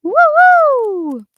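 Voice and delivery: loud falsetto woo-hoo, swooping down; high-pitched excited whoop. Shown as a demonstration. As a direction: whoop it out in head voice. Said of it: "give me a high-pitched, excited".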